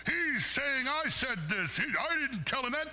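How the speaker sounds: high, slow sneering imitation of Bongino; mocking Bongino. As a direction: parody him as a petulant complainer. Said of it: "Bongino got pissed ... and went".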